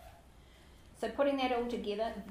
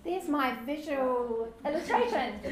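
mock excited discovery; 3 semitones higher, 4 dB louder than usual. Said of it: "I was pretty excited about that".

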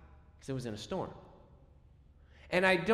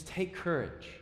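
softer, gentle, calm words; kind reassurance; 4 semitones lower, 7 dB quieter than usual. Acted. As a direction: soothe the frightened men. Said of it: "very kind words filled with warmth".

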